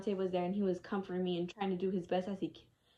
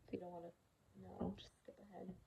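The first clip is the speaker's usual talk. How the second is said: soft hushed warning; warning viewers before the graphic part, whispered; whispered aside; 15 dB quieter than usual. Real, not acted.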